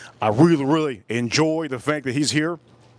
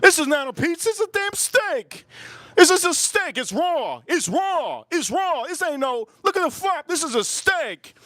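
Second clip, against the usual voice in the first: much higher squeaky disbelief; outraged self quote; 12 semitones higher than usual. Retold or imitated. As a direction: protest about a raw pizza in a mock high voice. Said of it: "I'm like".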